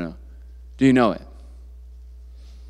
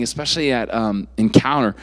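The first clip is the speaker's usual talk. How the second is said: ordinary delivery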